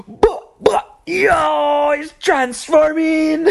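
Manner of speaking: very high, loud mock Caribbean shout; imitated excited yell. Caribbean friend amazed at Transformers in theater, imitated yell. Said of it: "He's yelling in the theater".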